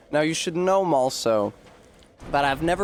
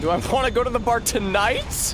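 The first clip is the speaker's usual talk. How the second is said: mock disbelief, pitched much higher; incredulous echo; 9 semitones higher than usual. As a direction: echo it back in high disbelief. Echoes question, high and incredulous.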